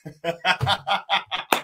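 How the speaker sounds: chuckling at own performance; a run of quick, real laughter. Real, not acted. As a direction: laugh it off after the act. Laughs after own acted begging.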